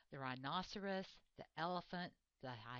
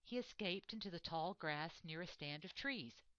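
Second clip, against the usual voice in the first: plain reading voice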